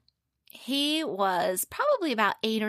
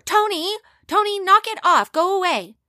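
full-throated shout, much higher; yelling louder; 11 semitones higher, 13 dB louder than usual. Acted. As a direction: scream it at the top of his voice. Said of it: "Sandy yelled louder".

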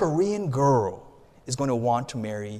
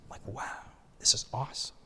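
whispered wonder; awed whisper; 3 semitones lower, 10 dB quieter than usual. Real, not acted. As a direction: breathe it out in amazement. Awed at free world travel.

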